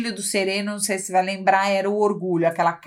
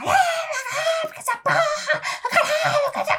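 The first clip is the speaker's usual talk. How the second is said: much higher, laughing fake rage; mock furious yell. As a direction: ham up the powerful type cursing, laughing through it. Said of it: "e aí o poderoso tá lá".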